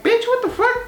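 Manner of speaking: very high, incredulous squeal; shocked outburst. Retold or imitated. Reacting to the neighbor's advice in outraged disbelief. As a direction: shriek it in disbelief.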